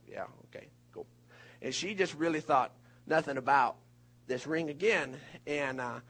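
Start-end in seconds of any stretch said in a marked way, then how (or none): 0.1-1.0 mumbled, barely voiced shrug; offhand mumble. Husband shrugging off wife's ring hints, barely voiced. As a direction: brush it off under the breath. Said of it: "I acted like I was just like".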